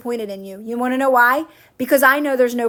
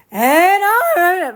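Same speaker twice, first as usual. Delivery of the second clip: high, louder, drawn-out rising voice; Bob Proctor; 6 semitones higher, 3 dB louder than usual. Retold or imitated. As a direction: swoop way up high, imitating a speaker. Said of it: "he would get real high on his voice".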